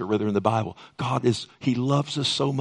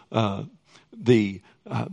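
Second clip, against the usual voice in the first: ordinary talk, nothing marked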